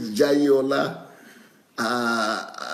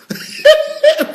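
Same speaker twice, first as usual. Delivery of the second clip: sudden high, loud burst of laughter; hearty high-pitched laugh; 10 dB louder than usual. Real, not acted. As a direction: crack up mid-sentence. Laughs while reading comment.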